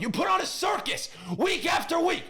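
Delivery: very high shouted complaint; exasperated, voice pushed way up; worked up. Real, not acted. Fan scolding the coach, shouting and worked up. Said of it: "getting worked up about the Jets".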